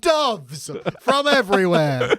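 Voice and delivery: slow, grand showman voice; mock magician. Joking about magic on a podcast, slow showman announce. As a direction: announce it like a stage magician, slowly.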